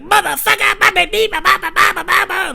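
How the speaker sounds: very high, loud mock gibberish; imitated drunk babble. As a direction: babble wildly like a caricatured drunk. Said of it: "And he's like".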